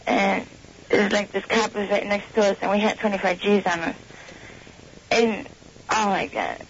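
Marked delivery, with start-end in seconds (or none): none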